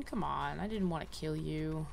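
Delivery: ordinary talk, nothing marked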